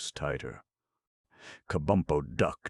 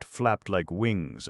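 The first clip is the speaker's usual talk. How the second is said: plain reading voice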